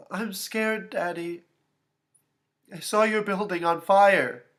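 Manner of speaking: put-on high, scared little-girl voice; frightened child on the phone; near tears. A narrator's reading. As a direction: confess fear to Daddy, close to crying. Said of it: "She was about to cry again".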